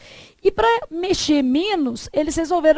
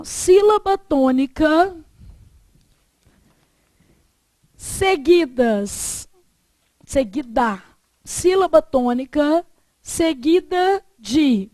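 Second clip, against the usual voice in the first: slow, drawn-out dictation with long gaps; slower than usual. Shown as a demonstration. Teacher dictates a note for students to copy, slow dictation. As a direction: dictate phrase by phrase, stretching final syllables. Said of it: "Vou ditar pra você".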